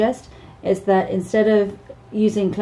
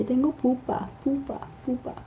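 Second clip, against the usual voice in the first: put-on child's voice; 7 semitones higher, 8 dB quieter than usual. Child saying they are hurt.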